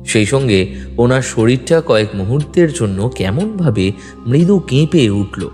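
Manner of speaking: normal talking voice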